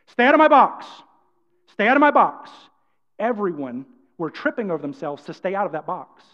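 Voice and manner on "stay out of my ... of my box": loud, higher bold command; bold, forceful warning. Shown as a demonstration. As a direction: say it boldly, projecting loud. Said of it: "we want you to say it boldly".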